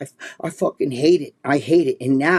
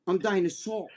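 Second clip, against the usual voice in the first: ordinary talk, nothing marked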